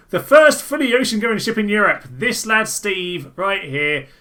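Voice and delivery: loud, hammed-up documentary narrator; over-the-top announcer voice reading the ship card; mock announcer. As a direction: boom it out like a grand ship advert. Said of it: "I'm gonna stop with this joke now".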